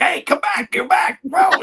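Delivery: high urgent call, half whispered in the middle; high-pitched mock shout to a revived patient. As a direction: call him back, pleading loudly. Calling a patient back to life.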